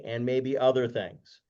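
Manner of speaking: ordinary talk, nothing marked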